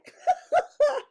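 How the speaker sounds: high, loud burst of laughter; real laugh. Real, not acted. Laughs at her own younger self. (grand éclat de rire aigu)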